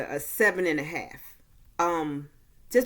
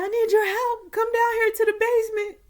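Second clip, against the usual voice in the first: high-pitched call up from below; a mom shouting up the stairs, pitched high; yelling from the basement; 4 dB louder than usual. Retold or imitated. Mom calls Claire down from the basement, high urgent yell. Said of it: "her mom was yelling from the basement".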